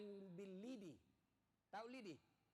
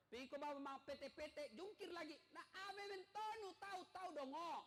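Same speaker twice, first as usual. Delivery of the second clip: harsh nagging wife; 6 semitones higher than usual. Retold or imitated. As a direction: berate the husband for every failure. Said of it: "Kalau kita bicara kasar terus".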